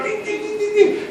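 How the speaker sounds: high, soft little tin tin of tiny cars; tiny car noise. Retold or imitated. Describing the little Mexico City cars as ants, high soft imitated beeps. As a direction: chirp it like small toy cars. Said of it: "como hormiguita".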